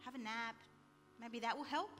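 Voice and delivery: low, soft, offhand reassurance; dismissive husband. Retold or imitated. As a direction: play down the problem quietly, low-pitched. Husband brushing off her sleepless night.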